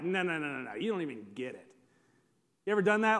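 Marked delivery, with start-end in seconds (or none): 0.0-1.7 low, quick, quiet brush-off; muttered mock dismissal, much lower; dismissive interrupter. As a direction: imitate someone cutting in dismissively. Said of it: "and they're like".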